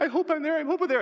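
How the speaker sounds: high, hurried hoping; high-pitched, repeated hopeful cry; anxious hopeful runner. Acted. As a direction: chant it high like a scurrying cat. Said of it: "That's how some of you are gonna enter heaven".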